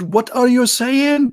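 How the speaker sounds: high, slow, in-your-face bark; mock drill-sergeant yell. Drill sergeant yelling at a recruit who speaks no English, high and slow. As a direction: drag each word out like a sergeant's yell. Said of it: "they scream in your face".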